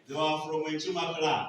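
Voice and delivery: usual speaking voice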